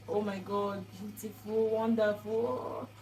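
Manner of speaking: mild dreamy gushing, last word drawn out; retold happy awe. Recalling hopes on moving into that house, mildly happy awe. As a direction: gush lightly, stretching the final word. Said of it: "I was so happy, full of hope".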